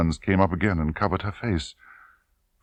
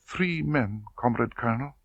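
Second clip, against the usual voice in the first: higher and softer, reluctant; timid girl confessing to the colonel; cowed young woman; 7 semitones higher, 5 dB quieter than usual. Acted. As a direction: answer as if shrinking away. Tatiana answers under pressure.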